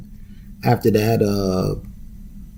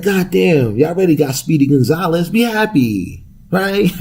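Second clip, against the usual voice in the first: higher, half-joking scolding voice; mock exasperated retort; 8 semitones higher than usual. Host mocking Twitter users, in a high teasing voice. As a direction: play it up like teasing a friend.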